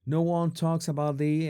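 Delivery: usual speaking voice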